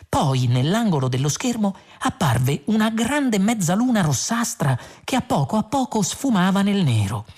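ordinary delivery, nothing marked

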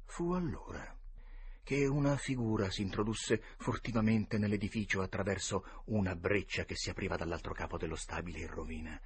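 usual speaking voice

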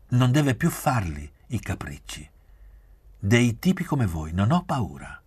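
stern, emphatic scolding; harsh firmness. A narrator's reading. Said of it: "Parlò con durezza".

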